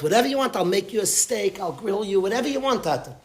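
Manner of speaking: a hint of the daughter. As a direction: offer it all up, lightly voiced. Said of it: "She said".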